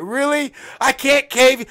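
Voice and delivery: acted high, loud disbelief; over-excited yelling. Reacting to Jay's answer, hammed-up excited yell. Acts out his eager reaction.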